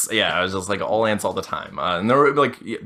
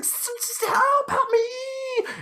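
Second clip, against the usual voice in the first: very high, drawn-out squeal for help; hammed-up cry for rescue; mock desperate plea; much slower than usual. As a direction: stutter into a long, comic falsetto wail. Acts out plea for a guest.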